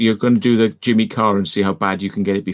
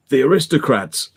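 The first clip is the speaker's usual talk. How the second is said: shouted punchline; 6 semitones higher than usual. Retold or imitated. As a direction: belt out "The Aristocrats" like a showman.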